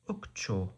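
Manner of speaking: "Ukcho" with the stress on cho; final-syllable stress. Shown as a demonstration. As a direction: model "Ukcho", leaning on the last syllable. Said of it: "With a stress on the final syllable".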